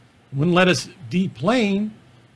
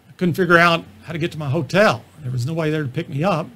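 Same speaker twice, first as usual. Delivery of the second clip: usual speaking voice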